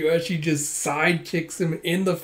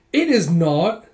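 loud, higher acted protest; indignant denial; 4 semitones higher, 7 dB louder, slower than usual. Girl denies her nose is bleeding, indignant. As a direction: deny it hotly.

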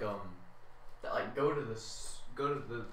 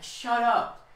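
loud, high-pitched outburst; exasperated; 7 semitones higher, 7 dB louder than usual. Acted. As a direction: shout down the imagined teasers. Snaps back at imagined name-callers.

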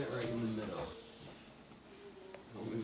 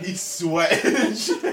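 slow, louder, laughing; 4 semitones higher, 6 dB louder, slower than usual. Real, not acted. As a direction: laugh through the words. Laughing at the drinker.

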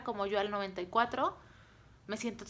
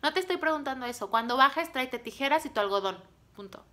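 raised, commanding voice dropping on the last word; bossy attitude; 4 semitones higher, 5 dB louder than usual. Retold or imitated. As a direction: give orders that allow no argument. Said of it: "fíjense mi actitud".

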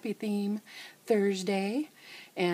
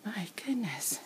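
whispering; 3 semitones higher, 6 dB quieter than usual. Real, not acted. Catching breath after moving dolls.

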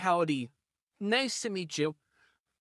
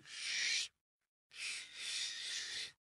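whispered shush to silence them; hushing whisper; 3 semitones lower, 12 dB quieter than usual. Acted. Howdy hushing the screaming neighbour in a whisper.

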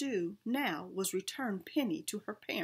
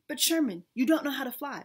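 louder, urgent warning; worried shout; 4 semitones higher, 7 dB louder than usual. A narrator's reading. Peabody warning Sherman from the ground, loud and worried. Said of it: "Mister Peabody shouted".

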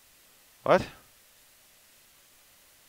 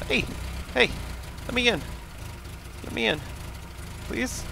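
high pleading voice; 10 semitones higher, 5 dB quieter than usual. Pretend. Begging to be let in.

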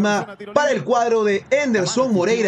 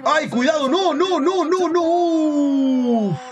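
panicked fan; 8 semitones higher, much slower than usual. Real, not acted. Fan panics at a rival attack.

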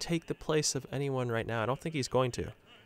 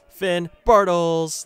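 long, loud stretched cry of the name; drawn-out goal shout; 4 semitones higher, 10 dB louder, much slower than usual. Real, not acted. Gamer hails his striker with a long, loud goal roar. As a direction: roar "Finn Bartels!" as he scores.

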